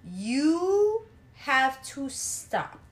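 very slow, deliberate warning; slow emphatic. Real, not acted. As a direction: drag each word out to insist. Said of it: "emphatic moral warning".

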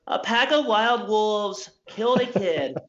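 mock newscaster cadence; hokey news anchor. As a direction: ham up a TV news teaser. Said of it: "Why do T V news reporters talk like this?".

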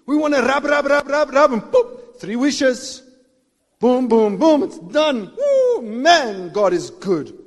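loud, playful rub-rub-boop; mock genie rubbing. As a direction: lampoon wish-hungry believers. Mocking genie-rubbing Christians.

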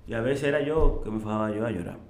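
normal talking voice

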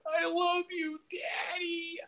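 put-on very high, tearful little-kid voice. Telling his late father he loves him, in a very high childlike cry. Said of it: "like a little kid".

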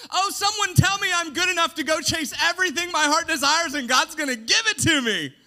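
loud, high mock plea; mocking the culture. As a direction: parody the culture's self-talk. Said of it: "the culture's message".

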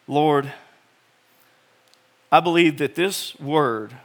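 earnest spoken prayer. Real, not acted. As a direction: pray it sincerely to God. Pastor prays before sermon.

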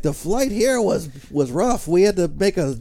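loud, high-pitched comic at a roast; Gilbert Gottfried. Retold or imitated. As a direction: push it up high and blare the punchline. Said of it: "Gilbert Gottfried did a roast in New York".

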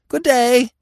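very high, loud call from above; shouting down. A narrator's reading. Squirrel calling down to Bambi, loud high shout. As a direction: call it down from the treetop. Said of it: "he shouted down".

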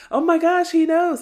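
loud, high-pitched gasping exclamation; mock shocked squeal. [name] reacting to the news, mock shocked squeal.

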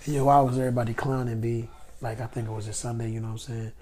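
soft low murmur; hushed, husky voice; whispering. Real, not acted. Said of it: "Why are you whispering like that".

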